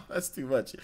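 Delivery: real laugh breaking around the words. Real, not acted. Streamer cracking up at viewers' ideas, laughing. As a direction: crack up while talking. Amused by chat's messages.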